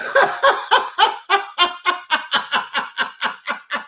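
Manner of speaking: long, high breathless laugh. Real, not acted. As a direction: crack up in a rattling run of laughter. Host laughs at the suspect.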